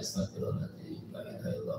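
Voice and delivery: breathy whispered prayer; whispered dhikr. Shown as a demonstration. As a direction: whisper the words of remembrance. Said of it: "kita pun begitu ya". (dzikir berbisik)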